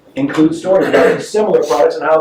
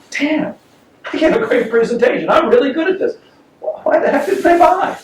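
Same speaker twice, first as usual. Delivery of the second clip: higher, mock self-satisfied; smug self-talk; 11 semitones higher than usual. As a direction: play the pleased salesman. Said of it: "I walk out going".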